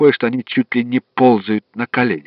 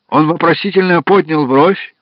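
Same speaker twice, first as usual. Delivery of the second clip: ordinary delivery, nothing marked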